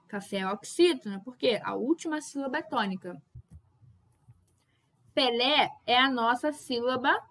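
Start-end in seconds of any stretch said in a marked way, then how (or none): none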